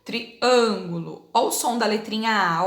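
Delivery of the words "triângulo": Triângulo slowly, the â closed and nasal. Shown as a demonstration. Teacher showing pupils the closed â in Triângulo. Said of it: "mais fechada".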